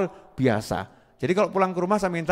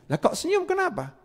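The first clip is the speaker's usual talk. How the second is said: puzzled visitor; 10 semitones higher, 5 dB quieter than usual. Retold or imitated. Voicing a visitor's question.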